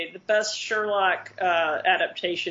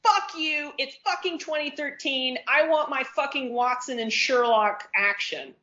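high-pitched, indignant fan rant; comic outraged rant; 6 semitones higher than usual. Real, not acted. Fan demanding romance in Sherlock films, high-pitched outrage.

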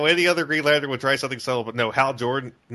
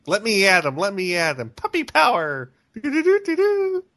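high, excitable cartoon puppy battle cry; Scrappy; 7 semitones higher than usual. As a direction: rise from eager pleas to a high-pitched fanfare. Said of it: "the Scrappy isn't annoying".

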